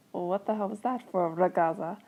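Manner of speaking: lightly attempted Italian accent; a touch of Italian accent on an indignant question. A narrator's reading. Romano asks after the pillow hit, light Italian accent. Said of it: "he asked you in his Italian accent".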